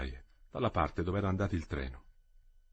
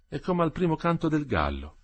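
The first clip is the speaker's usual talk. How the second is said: plain reading voice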